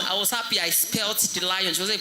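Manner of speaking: usual speaking voice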